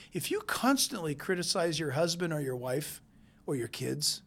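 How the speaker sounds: usual speaking voice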